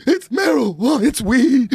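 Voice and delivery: high, wailing fake sobs; imitating a hysterical crybaby confession; mock crying. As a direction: bawl it out, hammed up. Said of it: "And I'm crying".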